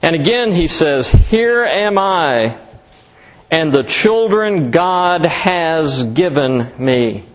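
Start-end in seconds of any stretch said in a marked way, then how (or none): 1.1-7.2 very slow, each word weighed; slow scripture reading. Shown as a demonstration. Preacher reading the verse to the congregation, very slow and deliberate. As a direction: lay out the verse slowly to the congregation. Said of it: "You could say it this way".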